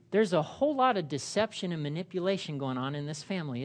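normal talking voice

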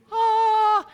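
high, loud sustained "ahh"; playful high-pitched held note. Pretend. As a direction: sing out one long, high "ah". Sound while drawing himself.

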